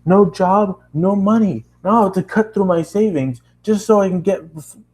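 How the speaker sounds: put-on lecturing the girl angrily about savings; angry rant. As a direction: scold her with mounting frustration.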